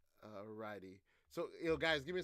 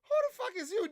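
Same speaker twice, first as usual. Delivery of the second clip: shrill, much higher yelled disbelief; mock Trump outrage; 4 dB louder than usual. Trump snapping at Elon, high outraged yell. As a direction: squeal it like an offended boss. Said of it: "Trump is like".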